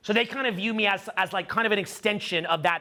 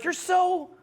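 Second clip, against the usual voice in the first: high breathy-free swoon, pitched way up; mock swooning girlfriend; 11 semitones higher than usual. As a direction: play the gushing girlfriend, pitch jumping high. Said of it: "They're like".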